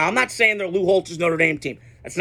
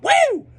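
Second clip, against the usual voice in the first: gleeful woo; 5 dB louder than usual. Real, not acted. Taunting Finebaum after Clemson wins.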